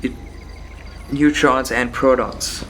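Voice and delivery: ordinary talk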